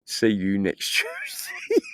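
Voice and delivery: breaking into laughter mid-phrase; can't hold the punchline in without cracking up; laughing through it. Retold or imitated. Laughing while quoting his partner's cheeky insult. Retelling wife's rude joke, cracking up.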